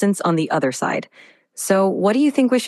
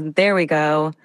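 ordinary delivery

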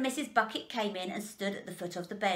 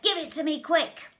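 raised, loud urgent order; urgent demand; 7 semitones higher, 5 dB louder than usual. A narrator's reading. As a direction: demand it at once, louder and higher. Father urgently demands the ticket.

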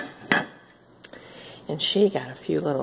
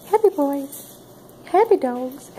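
high, loud greeting to the dogs; happy dog talk; 7 semitones higher, 6 dB louder than usual. Real, not acted. Fussing happily over her dogs.

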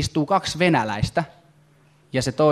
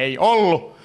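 heckler; much slower than usual. Retold or imitated. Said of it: "Joku tuolla silleen".

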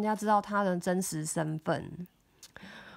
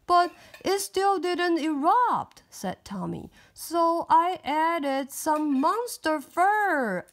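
put-on higher, louder frustrated boy; upset boy voice; 7 semitones higher, 5 dB louder, slower than usual. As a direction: complain that it failed. Tommy complains.

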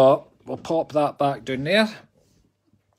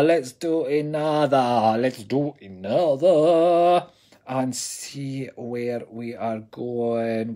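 drawn-out sing-song; 3 semitones lower, much slower than usual. Acted.

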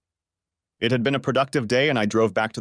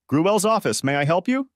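higher, bright phone greeting; receptionist voice; 7 semitones higher than usual. A narrator's reading. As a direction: answer the office phone politely.

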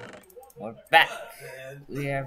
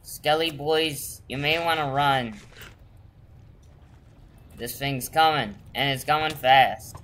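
loud warning; 2 semitones higher, 7 dB louder than usual. Real, not acted. Warning skeletons of the storm.

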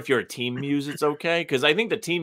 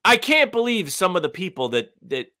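ordinary talk

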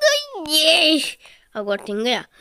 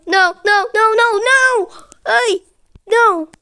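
loud high repeated cries; panicked refusal; 8 semitones higher, 6 dB louder than usual. Real, not acted. Gamer about to be knocked out, high loud panic. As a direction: climb higher and louder with each no.

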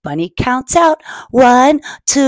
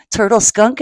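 ordinary delivery, nothing marked

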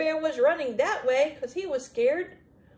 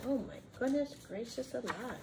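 ordinary delivery

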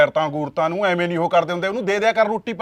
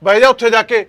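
loud, barked order to sit down; husband ordering his wife to go sit, loudly; 2 semitones higher, 8 dB louder than usual. Acted. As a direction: order her away, loudly.

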